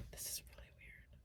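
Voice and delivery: hushed scared whisper. Real, not acted. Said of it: "Why are you whispering?".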